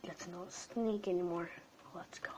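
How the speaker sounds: soft hushed whisper. Real, not acted. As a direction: whisper so nobody wakes. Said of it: "start being quiet now".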